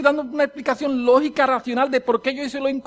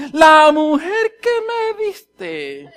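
high, loud, drawn-out accusation; hammed-up finger-pointing excuse; Adam blaming; 6 semitones higher, 6 dB louder, much slower than usual. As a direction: stretch it out, shifting the blame. Acts Adam blaming the woman.

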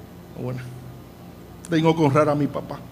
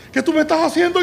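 put-on startled boy; 10 semitones higher than usual. Boy surfacing after being dunked.

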